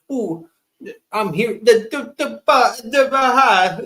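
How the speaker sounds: high, loud, hammed-up mocking imitation; mock Kamala. Mocking Kamala's accents to his buddy, high and loud. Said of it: "so many different fucking accents".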